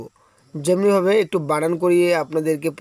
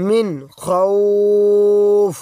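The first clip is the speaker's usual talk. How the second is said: মিন খউফ with খউফ held very long and louder; long drawn madd; 4 dB louder, much slower than usual. Shown as a demonstration. Quran teacher showing the stop on খউফ. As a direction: stretch খউফ slowly, full breath. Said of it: "দুই আলিফ টানতে হবে".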